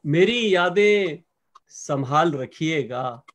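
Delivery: slow, lingering verse with stretched words; ghazal recitation. Acted. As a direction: present each half-line to the mushaira audience. Poet recites ghazal opening.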